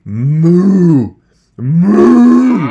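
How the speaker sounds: loud long mooing "Moo"; cow moo. Retold or imitated. Teacher mooing like a cow to show the sound. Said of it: "Sounds like a cow".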